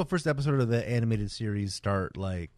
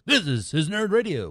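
lightly imitated, higher-pitched announcer; mock announcer voice; 7 semitones higher, 3 dB louder than usual. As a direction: pretend to be the cartoon hero's voice actor doing a station ID. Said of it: "His voice being like".

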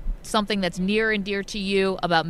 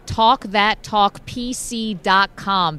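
slow, punchy plug of the website; ad read; 4 dB louder, much slower than usual. Acted. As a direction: spell out the web address deliberately.

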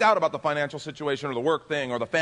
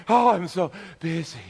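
louder, winded "so busy" like a sprinter; mock out of breath; 5 semitones higher, 6 dB louder than usual. Pastor imitating a busy churchgoer, mock breathless. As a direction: huff it out, making fun of breathless busyness. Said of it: "you sound like you just ran up a flight of stairs".

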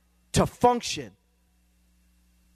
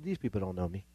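low, much softer aside; quiet, resigned-sounding self-quote; head-shaking dismissal; 8 semitones lower, 11 dB quieter, faster than usual. Retold or imitated. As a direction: drop right down as if saying it to yourself. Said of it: "I just shake my head and said".

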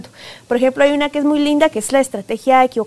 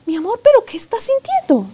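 much higher, sweet pleading question; coaxing mother; 10 semitones higher than usual. Retold or imitated. A mother begging her silent son to name his feelings, sweetly high. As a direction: coax it gently out of a silent child.

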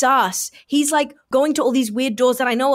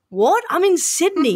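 mock-baffled reply, pitched up; acted puzzlement; imitating confusion; 7 semitones higher than usual. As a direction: play a thrown friend on the phone. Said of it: "He's so confused".

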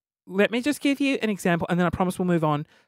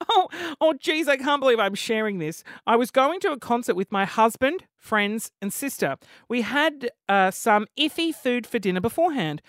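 louder, lively read-aloud with punched words; dramatic story reading; 4 semitones higher, 4 dB louder than usual. Acted. Podcaster reading a listener's embarrassing story, animated reading. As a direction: perform the confession with relish.

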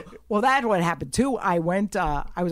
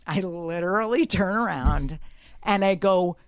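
ordinary delivery

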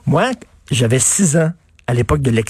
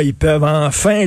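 ordinary delivery

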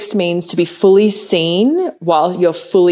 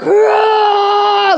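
long, high-pitched mock roar; imitated roar; 13 semitones higher than usual. Podcast guest imitates a woman roaring through birth, long high roar. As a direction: imitate a labouring mother's drawn-out roar. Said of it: "she's, like, roaring".

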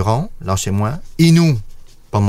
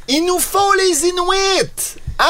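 high slow voice. Pretend. Playful high voice continues.

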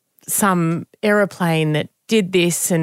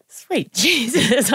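slow, drawn-out, higher "Sweet Jesus"; exasperated; 5 semitones higher, much slower than usual. Retold or imitated. Daughter answering dad's jab about her looks, mock-exasperated and slow. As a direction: drag it out in mock despair. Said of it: "And I went".

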